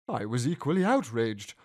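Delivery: plain reading voice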